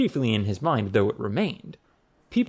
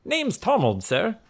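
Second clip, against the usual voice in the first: lightly voiced, higher-pitched young newcomer; eager young bellman; 9 semitones higher than usual. A narrator's reading. New bellman giving his name to the boss, eager.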